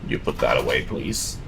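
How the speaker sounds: ordinary talk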